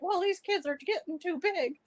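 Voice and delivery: high mock imitation of Cody. Host mocking Cody's comment, high parody voice. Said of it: "Cody is all like".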